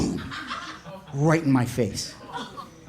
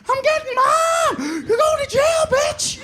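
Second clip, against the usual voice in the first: high, slow shrieked threat; screaming kid. Retold or imitated. Little brother screaming at big brother as he runs for mom. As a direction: shriek it over your shoulder while running.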